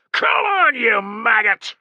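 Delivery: very high, loud, slow challenge; roared taunt. Acted. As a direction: dare the berserker to come. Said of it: "roared Tullus".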